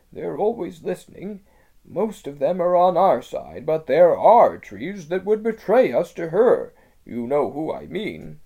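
high, urgent beaver voice; lisping buck-toothed beaver. Acted. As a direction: warn of betrayal with unease. Beaver explains.

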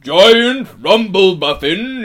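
high, very loud, drawn-out giant voice; booming giant. A narrator's reading. Giant gives Aslan his name, booming and deferential. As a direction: bellow it slowly like an obliging giant. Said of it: "said the giant".

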